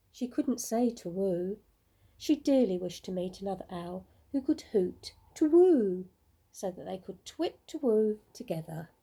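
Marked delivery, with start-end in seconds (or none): none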